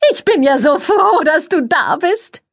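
very high, sugary society voice; gushing press lady. A narrator's reading. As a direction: coo it, all air kisses and fuss. Cordelia voiced in story.

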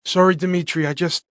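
slightly rushed, anxious; distressed. Acted. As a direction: blurt it out in distress. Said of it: "I said in distress".

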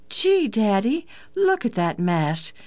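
put-on high-pitched, wide-eyed child's wonder; amazed exclamation. A narrator's reading. Daughter to father on seeing the mast, amazed. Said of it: "she exclaimed".

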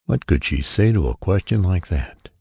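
usual speaking voice

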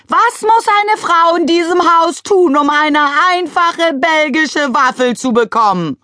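high, loud, slow bellow. A narrator's reading. Mrs McCrumpet bellowing from the window for waffles. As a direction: roar the complaint. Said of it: "brüllte Misses McCrumpet".

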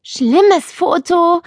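put-on high, slow grumble of a young girl; grumbling girl. A narrator's reading. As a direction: complain about the photo, drawn out. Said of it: "meckerte Nele".

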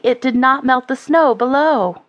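plain reading voice

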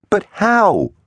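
very high-pitched baffled demand. A narrator's reading. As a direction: demand it, voice shooting up. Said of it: "Teggs demanded".

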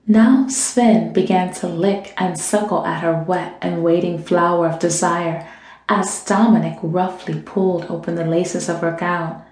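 usual speaking voice

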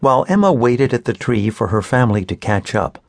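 ordinary delivery

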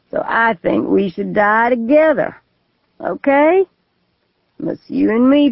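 put-on slurred, weary drunk voice, lightly done; a drunk man musing darkly; drunk suicidal man. Drunk man talks of dying.

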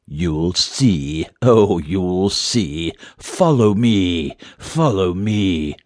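very slow, eerie beckoning; drawn-out voiced stage whisper; whispery mysterious voice. A narrator's reading. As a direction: lure them on with stretched-out words. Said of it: "the voice whispered back".

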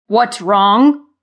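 loud, menacing creature growl. A narrator's reading. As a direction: growl the question up from below. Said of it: "Thud growled from below".

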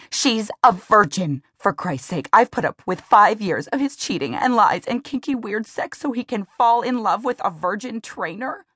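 hurt turning to outrage; upset tirade. Acted. Emmy pours out her betrayal to Lee, upset tirade.

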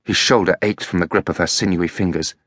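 usual speaking voice

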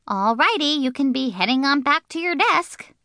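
put-on high, breezy kid voice dismissing the grown-up; bossy little girl. Junie B sending the teacher off, high and breezy. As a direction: shoo the teacher off, high and sure.

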